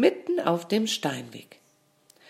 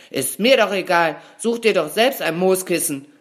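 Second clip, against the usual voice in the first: louder, higher, dismissive outburst; angry bellow; 4 semitones higher, 6 dB louder than usual. A narrator's reading. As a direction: bellow it to brush the friend off. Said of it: "brüllte ihr Freund". (wütend gebrüllt)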